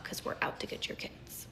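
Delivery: conspiratorial whisper; hushed mock confession; whispered aside. Mock aside to skeptical parents.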